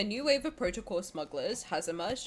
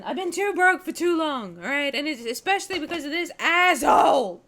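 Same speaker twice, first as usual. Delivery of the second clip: loud, higher frustrated rant; angry rant; 5 semitones higher, 9 dB louder than usual. Acted. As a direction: shout it out in frustration. Ranting about being broke.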